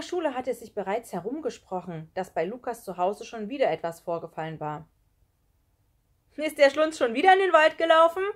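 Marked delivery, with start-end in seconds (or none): 6.4-8.2 loud, high taunting question; high-pitched schoolboy call. A narrator's reading. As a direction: shout it across a classroom. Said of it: "rief Kai".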